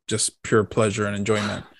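ordinary talk

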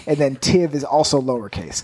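usual speaking voice

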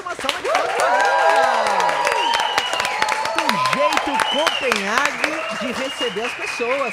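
high, loud, slow hype call; rally the crowd like a host; announcer shout. Real, not acted. Host calls for applause.

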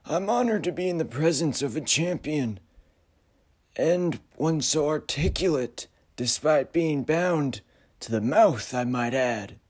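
uncanny figure, mock courtesy; eerie high voice. Frederick flatters stranger.

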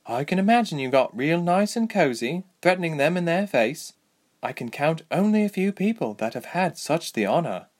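high-set, wry woman fighter; Lieselot. Acted. Voices Lieselot's joke.

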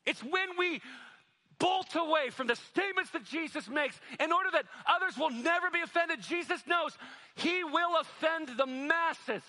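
high, loud, driving sermon voice; fiery preaching. Acted. Pastor preaching loudly to the church, fervent. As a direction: preach it at full force to the congregation.

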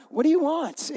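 high-pitched, put-upon question; joking, exaggerated exasperation; mock exasperated. Jesus answers the pushy mother, mock exasperated. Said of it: "No, he didn't say it like that".

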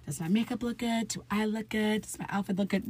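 lightly acted mirror primping, kept small; self-checking in mirror. Acting out checking herself in mirror.

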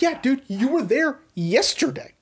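mocking reply. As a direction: toss it off up high, dripping sarcasm. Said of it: "He goes".

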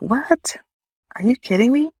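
can't believe what you just heard; shocked disbelief. Retold or imitated. Reacting to cancer diagnosis.

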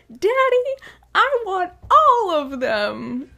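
put-on high, loud, drawn-out whiny kid voice; loud high child pleading with her father; spoiled little girl. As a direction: beg Daddy like a greedy little girl. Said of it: "And then this girl is like".

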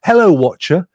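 mock radio host hello; radio presenter greeting. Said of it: "They go".

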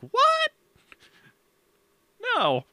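a sudden high shocked what; shocked disbelief. Retold or imitated. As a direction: yelp it like you've been ambushed. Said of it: "I was like".

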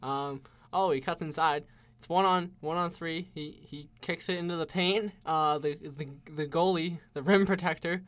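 lightly hyped play-by-play call; frantic mock sports commentary. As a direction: announce each move with mock excitement. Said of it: "I'll play-by-play now".

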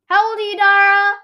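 loud, high call to another room. Real, not acted. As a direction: shout the question to her brother. Calling out to brother.

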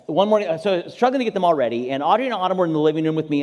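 normal talking voice

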